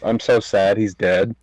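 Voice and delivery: ordinary talk, nothing marked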